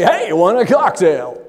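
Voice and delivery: big, loud put-on hosting voice; showy stage voice. Speaker jokes about greeting dinner guests at home, showy put-on voice. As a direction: boom it out like an announcer at a dinner party. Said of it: "not allowed to talk this way at home".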